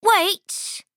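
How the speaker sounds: sudden high "Wait!" call; clever goat. Acted. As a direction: stop the troll with a quick high cry. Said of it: "Baby's clever and says".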